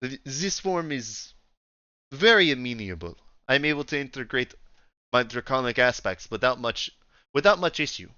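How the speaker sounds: put-on Russian accent, deliberate at first; Russian-accented officer making a report. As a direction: play her with a Russian accent. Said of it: "she's got a thick Russian accent".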